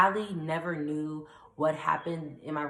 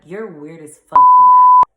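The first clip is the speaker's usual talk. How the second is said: slow, much louder scolding; loud disgusted condemnation; 23 dB louder, slower than usual. Real, not acted. Condemning sister.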